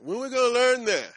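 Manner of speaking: loud, rising preacher's cry; preaching shout. Real, not acted. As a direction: belt it out to the congregation. Preacher hammering the point.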